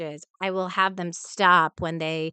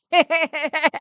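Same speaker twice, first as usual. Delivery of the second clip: laughing; 9 semitones higher, 8 dB louder than usual. Real, not acted. Joke about eating grandma.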